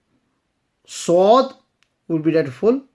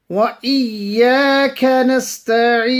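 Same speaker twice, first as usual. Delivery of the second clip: melodic tajweed recitation, a touch higher; Quran recitation; 6 semitones higher, 3 dB louder than usual. Acted. Teacher reciting the verses for students, melodic and measured.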